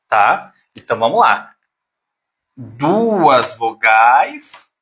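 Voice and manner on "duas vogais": drawn out slowly. Shown as a demonstration. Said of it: "teacher stressing at the board".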